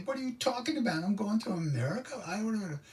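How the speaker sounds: very high, squeaky inner protest; comic freaked-out squeal; mock panic. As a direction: argue back in a hammed-up falsetto fluster. Panicked inner voice argues back.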